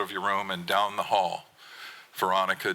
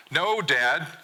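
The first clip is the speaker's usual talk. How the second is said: yell; 7 semitones higher than usual. Acted. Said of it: "you yell back at me".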